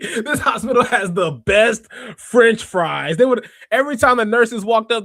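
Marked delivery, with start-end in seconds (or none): 0.0-3.2 slow, loud, laughing shout about fries; excited kid shout. Retold or imitated. Little boy in hospital telling nurses, laughing loud shout. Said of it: "I was like".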